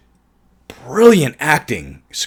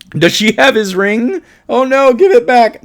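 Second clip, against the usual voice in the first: high playful plea; 10 semitones higher, 5 dB louder than usual. Pretend. Joke about the ring.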